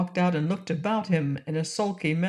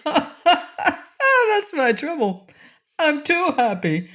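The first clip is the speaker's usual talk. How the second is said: laughing; 10 semitones higher, 4 dB louder, much slower than usual. Acted. Jolly king laughing.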